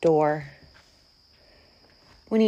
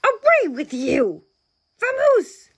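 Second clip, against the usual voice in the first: high, exclaimed banishment; indignant bear, voice climbing very high; outraged bear; 13 semitones higher, 4 dB louder than usual. A narrator's reading. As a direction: shoo them off in outrage. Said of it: "rumbled the bear".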